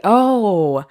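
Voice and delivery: ordinary delivery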